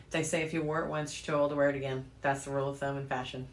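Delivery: ordinary talk, nothing marked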